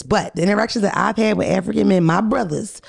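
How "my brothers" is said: ordinary talk, nothing marked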